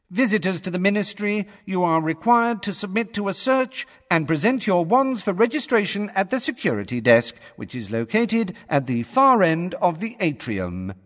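high, cool official announcement; cool female voice. A narrator's reading. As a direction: recite security rules as an impersonal female announcer. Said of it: "said the cool female voice".

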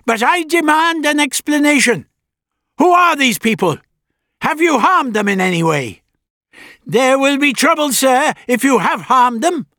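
put-on high, loud demanding old man; stern old doctor. A narrator's reading.

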